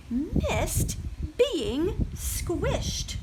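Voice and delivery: very slow, wistful drawl. A narrator's reading. Librarian reading to children, slow and wistful. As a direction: linger on it sadly. Tender emotional moment.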